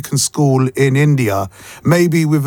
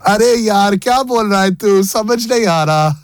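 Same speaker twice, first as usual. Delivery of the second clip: higher, hammed-up Hindi with a Mumbai lilt; mock Indian accent; 7 semitones higher than usual. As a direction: play a frustrated Mumbai guy, half-joking. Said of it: "can you say any weird Indian phrases".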